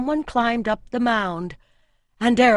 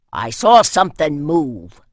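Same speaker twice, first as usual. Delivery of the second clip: man's voice; 4 semitones lower, 8 dB louder than usual. A narrator's reading. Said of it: "came a man's voice dulled through earth and stone".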